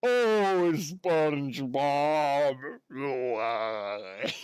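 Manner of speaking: very slow, despairing cartoon wail; showing Wins the classic Mrs Puff line, drawn out; Mrs Puff impression. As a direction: drag out SpongeBob in weary dismay. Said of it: "I can see him doing the classic".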